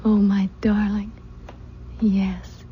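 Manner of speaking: breathy loving whisper. Acted. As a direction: whisper it close and intimate. Bride whispers to husband.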